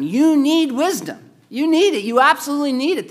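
high, loud, pressing the point home; rising preacher's insistence; forceful preaching. Real, not acted. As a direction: hammer it home to the congregation. Preacher drives home need.

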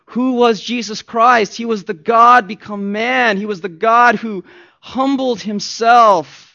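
impassioned preacher; fervent preaching. Acted. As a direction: preach it with conviction. Preaching at full force.

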